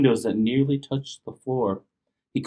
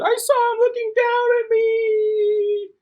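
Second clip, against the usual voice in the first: shouting. Acted. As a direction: yell it out in fright. Monster runs to mom.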